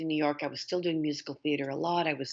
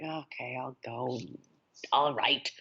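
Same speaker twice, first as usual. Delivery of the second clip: low, reluctant retold acceptance; grudging agreement; 3 semitones lower, slower than usual. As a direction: give in with mild reluctance.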